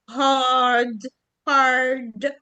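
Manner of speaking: hard as haad with the A long; hard without any R. Shown as a demonstration. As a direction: stretch the A in hard and drop the R. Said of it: "ل long A".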